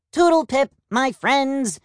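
high, bright toodle pip call; cheery peacock. Acted. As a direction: call out like a jolly bird. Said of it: "Plum called to the gathered flock".

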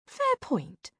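high-pitched sidekick conceding; high, lilting concession; Sabrina. A narrator's reading. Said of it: "Sabrina conceded".

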